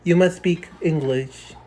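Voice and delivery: fake American accent. Kid insists on English, slow.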